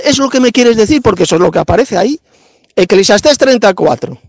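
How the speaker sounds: ordinary talk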